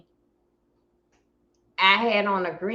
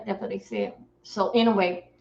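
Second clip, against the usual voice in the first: normal talking voice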